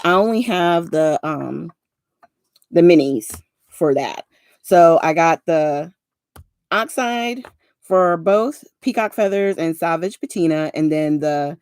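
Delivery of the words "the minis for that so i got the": usual speaking voice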